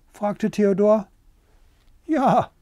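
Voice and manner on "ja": high, short clucky yes; cackling hens. A narrator's reading. Hens confirming to Theodor, cackling high. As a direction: answer like a hen, pitched up. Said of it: "gackerten die Hühner".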